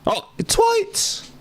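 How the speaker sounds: high squeal of delight; excited squeal. Real, not acted. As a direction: shriek his name happily. Excited at character.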